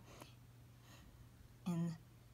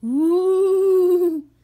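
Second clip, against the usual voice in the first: long, loud, high spooky ooh; ghostly wail; 9 semitones higher, 14 dB louder than usual. Acted. Brother voicing the cave with a loud spooky wail. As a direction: moan like a haunted cave. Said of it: "cried the deep, dark, mysterious cave".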